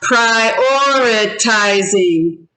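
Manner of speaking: "prioritizing" slowly in five separate beats; syllable by syllable. Shown as a demonstration. Teacher confirms five syllables to class, "prioritizing" syllable by syllable. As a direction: count out "prioritizing" as pri-or-i-ti-zing. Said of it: "Five syllables".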